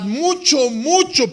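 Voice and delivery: high, loud stress on "mucho, mucho"; emphatic. Acted. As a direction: lean hard on "mucho" both times. Emphasizing the small debt.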